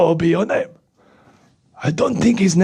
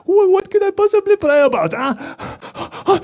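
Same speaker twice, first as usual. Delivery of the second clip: high fretting puppet voice; worried puppet; 12 semitones higher than usual. Acted. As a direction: puppet fretting over the prayer.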